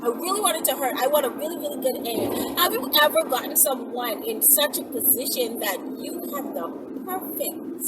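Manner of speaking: usual speaking voice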